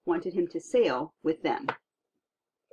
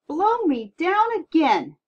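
slow, much higher and louder astonished cry; the stunned captain gasps once more; amazed exclamation; 10 semitones higher, 8 dB louder, slower than usual. Acted.

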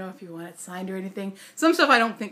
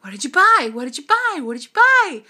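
excited, higher and louder questioning; eager fans asking; 5 semitones higher, 7 dB louder than usual. Retold or imitated. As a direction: pester like a curious fan. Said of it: "everyone always asks me".

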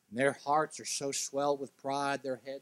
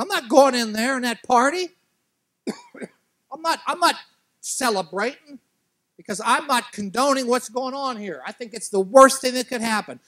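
much higher and louder, indignant; angry refusal; 12 semitones higher, 13 dB louder than usual. Acted. Older son refusing to join his brother's party, furious. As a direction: refuse flatly, outraged. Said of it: "he's so angry that he refuses".

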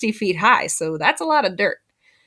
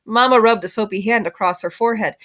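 ordinary delivery, nothing marked

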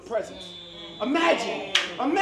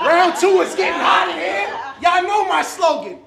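hype shout; 4 semitones higher, 7 dB louder than usual. Acted. Hyping the crowd.

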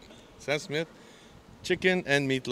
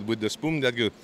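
normal talking voice

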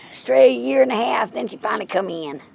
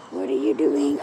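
low repeat; 10 semitones lower than usual. Real, not acted. Asking cat twice.

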